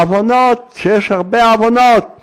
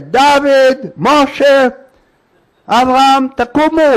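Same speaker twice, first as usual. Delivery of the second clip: raised-pitch mock yell of names; imitated calling out; 6 semitones higher, slower than usual. Woman alone at night calls out to pretend sons, mild mock yell. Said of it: "היא צועקת".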